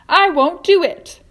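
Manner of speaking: loud, high, slow refusal. A narrator's reading. Earthworm refuses the plan, loud and high. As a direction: dig in and refuse.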